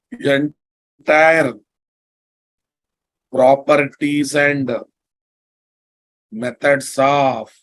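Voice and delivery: word by word, slowly; slow dictation. Shown as a demonstration. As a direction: spell it out for note-taking. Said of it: "dictating notes slowly".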